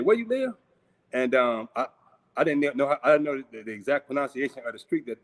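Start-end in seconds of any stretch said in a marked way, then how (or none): 0.0-0.5 pitched much higher, puzzled disbelief; a baffled asking again; puzzled repeat. Retold or imitated. Brother confused by the mispronounced street, asking again, puzzled. I had pronounced the street wrong.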